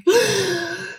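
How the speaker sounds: sharp high gasp of shock. Retold or imitated. Gasping at the doctor's six-month verdict, shocked. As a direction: gasp as if hearing bad news.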